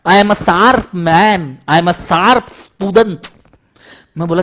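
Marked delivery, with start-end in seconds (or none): 0.1-3.2 high, loud, slow bragging pupil; boastful student Champat. Retold or imitated. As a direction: show off like a cocky schoolboy. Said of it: "Champat बोलता है". (शेखी बघारता छात्र, ऊँची आवाज़ में)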